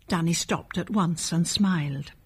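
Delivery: ordinary delivery, nothing marked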